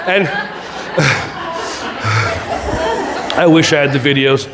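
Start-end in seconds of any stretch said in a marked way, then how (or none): 0.3-3.3 long, audible exhale; demonstrated sigh. Shown as a demonstration. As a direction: let out a long cooling breath. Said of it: "And then you go like that".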